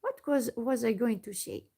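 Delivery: plain reading voice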